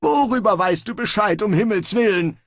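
fast, high, irritated question; annoyed. Acted. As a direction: snap out the question. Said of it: "fragte Pismeier verärgert".

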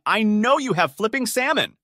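loud, high outraged yelling; angry high-pitched tirade, easing down at the end; screaming. A narrator's reading. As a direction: insist furiously at a shout. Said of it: "again screaming".

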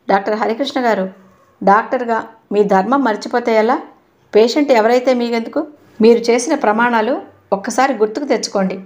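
ordinary delivery

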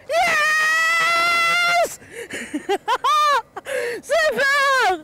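high, shouted cry of joy breaking into laughter; overjoyed cry. Real, not acted. Supporter hearing of the gold, crying out in joy. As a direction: burst out with relief and joy. Her skater wins gold.

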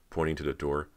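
usual speaking voice

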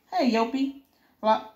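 ordinary delivery

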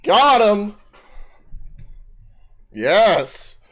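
loud triumphant shout; excited cheer. Real, not acted. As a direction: yell in victory. Victory cheer in game.

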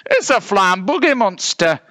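high character voice; high-pitched astonished bear; amazed bear. A narrator's reading. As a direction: exclaim it high, in awe. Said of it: "remarked the one with the biggest".

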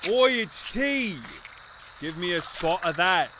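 low, soft British voice; British accent. Acted. Briton spots the tea, low British accent. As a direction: play a British character fussing over tea. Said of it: "Yo mom's so British!".

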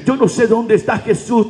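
normal talking voice

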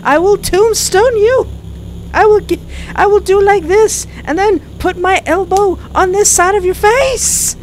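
loud, high raging threats; furious outburst. Acted. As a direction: roar out wrestling threats in a fury. Character erupts in wrestler rage.

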